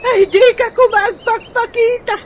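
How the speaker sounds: loud, high-pitched cry of exasperation, slowing at the end; a wife who has run out of patience, loud and high; frustrated outburst. Acted. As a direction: let it all burst out at once. Wife's outburst of frustration at Manolo.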